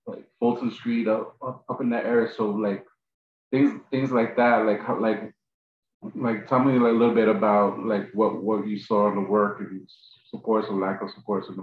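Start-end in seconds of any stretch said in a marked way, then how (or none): none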